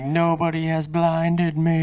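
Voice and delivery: hammed-up slow delivery of the line; mock quote. Friend quoting the movie, slow and high. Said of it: "It's like".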